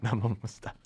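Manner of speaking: soft, laughing under the breath. Real, not acted. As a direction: chuckle it out quietly. Laughing, trailing off.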